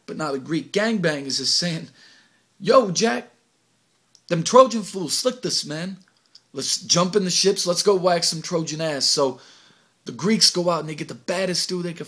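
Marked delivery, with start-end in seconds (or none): 2.6-9.1 higher, louder battle cry; loud rallying call. Acted. Greek gangbangers calling for revenge, loud. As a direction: rile up the crew.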